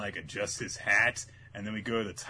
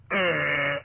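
loud, drawn-out grimace sound; grimacing noise; 3 semitones higher, 7 dB louder than usual. Retold or imitated. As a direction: groan out a silly face. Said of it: "makes a wonderful face at him".

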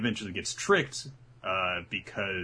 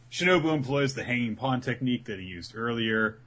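ordinary delivery

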